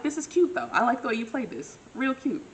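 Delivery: high delighted "this is cute"; charmed and flirty. Retold or imitated. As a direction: tease him, lifted and pleased. Herself charmed by his move.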